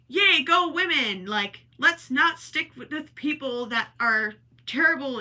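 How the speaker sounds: high, loud rallying cheer; mock cheer. Voicing the play's promised new ending, cheering.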